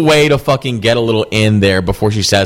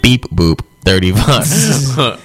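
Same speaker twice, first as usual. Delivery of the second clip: very slow robotic speech synthesizer; Stephen Hawking voice; 2 semitones lower, much slower than usual. Retold or imitated. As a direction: drag it out like a computer voice. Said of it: "Steven, what's your gamer tag?".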